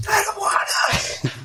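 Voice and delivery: high, slow mock whine; whiny kid. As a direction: whimper it like a sulky child. Friend voices the whiny player.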